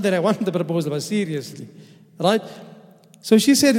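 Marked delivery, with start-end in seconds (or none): none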